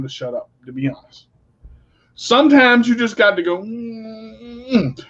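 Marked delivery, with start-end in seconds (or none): 3.6-5.0 soft drawn-out closed-mouth hum; held-back hum. Shown as a demonstration. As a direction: hum as if biting back words. Shows holding one's tongue.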